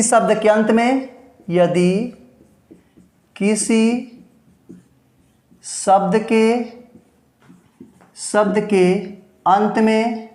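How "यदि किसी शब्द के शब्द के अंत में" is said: word by word, with long pauses; slow dictation. Shown as a demonstration. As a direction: dictate slowly for students writing it down. Teacher dictating the rule word by word. (रुक-रुक कर धीरे लिखवाते हुए)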